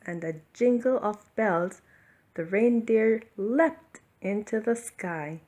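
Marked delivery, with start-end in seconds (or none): none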